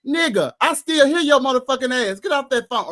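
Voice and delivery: Miss Pat scolding. Retold or imitated. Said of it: "Pat was like".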